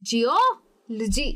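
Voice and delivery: "Geology" slowly split, strong O, pause; slow syllable demo of "geology"; stress on O. Shown as a demonstration. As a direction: stretch and punch jee-O, then lo-jee in "geology". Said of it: "उसको मैं जोर से कह रही हूँ".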